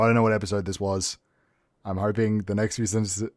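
usual speaking voice

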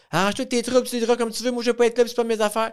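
high, mock scornful ex; angry ex-wife. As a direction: toss it off like a bitter ex. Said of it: "elle était pas".

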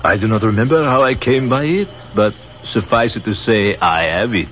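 put-on genial, in a light French accent; French captain offering his wine, in a French accent.

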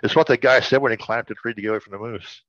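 ordinary talk, nothing marked

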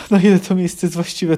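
ordinary delivery

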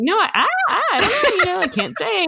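high, put-on innocent stammer with a laugh; playing coy. Mom pressed about moving the elf, playing coy. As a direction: dodge it in a high, sing-song mock innocence. Dodging child's repeated question.

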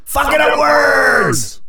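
high, drawn-out mock yell; mock outburst. Podcaster berating himself for botching a word, hammed-up high outburst. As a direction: wail it in exaggerated self-frustration.

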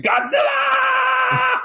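long drawn-out high yell; stretched, shrieking last word; shout. Retold or imitated. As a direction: howl the name as a dying cry. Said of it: "just shouting".

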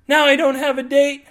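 high loud wailing cry; bawling. A narrator's reading. Big Feet Girl bawling in the middle of the mall. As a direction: wail it out in despair. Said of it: "she starts bawling".

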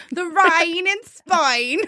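faked Australian accent. Shown as a demonstration. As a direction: exaggerate a bad Australian accent through closed teeth. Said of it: "it kinda sounds like Australian".

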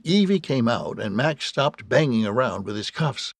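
ordinary delivery, nothing marked

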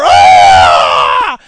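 long, very loud monster shriek; blood-curdling roar. A narrator's reading. Monster just blasted in the leg, shrieking roar. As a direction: roar it out in agony. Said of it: "It shrieked a blood-curdling roar".

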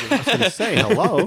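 laughing through the words; high, giggly aside. Real, not acted. Joking about Lysol spray.

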